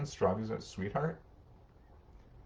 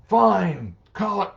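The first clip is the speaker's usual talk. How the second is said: louder, fed-up parent caving in; exasperated giving in; 11 semitones higher, 14 dB louder, slower than usual. Retold or imitated. Parent giving in to a child's demand, loud and exasperated. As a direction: snap it like a worn-out parent surrendering.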